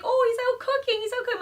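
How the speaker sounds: Minnie's voice. Retold or imitated. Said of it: "she's like".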